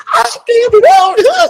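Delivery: high-pitched wailed imitation of a freak-out; screaming and crying. Imitating Julie learning Heart is here, screaming and crying. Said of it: "screaming and crying".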